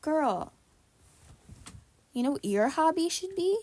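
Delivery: sassy scolding. Acted.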